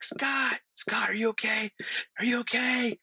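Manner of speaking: high-pitched retold yell. Teller calling for friend Scott after the crash, mock yelling. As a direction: call out high for a friend, no real volume. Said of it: "I'm, like, yelling for Scott".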